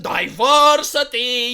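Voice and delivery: sarcastic slogan, drawn out; mock slogan. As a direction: boom it out like a stadium slogan. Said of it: "tuning in to some entertainment".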